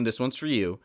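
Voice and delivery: ordinary talk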